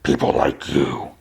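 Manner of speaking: low, eerie distorted echo. Acted. Mysterious host to Riri, low distorted echoing voice. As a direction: drop low and make it echo. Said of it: "Distorted echoing".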